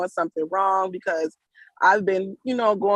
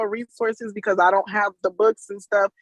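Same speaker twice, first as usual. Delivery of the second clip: ordinary delivery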